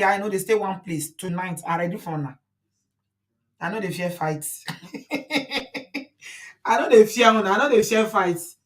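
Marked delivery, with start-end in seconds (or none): 4.7-6.0 quick unvoiced chuckle; a short breathy laugh. Real, not acted. Teasing side chick supporters with a breathy chuckle.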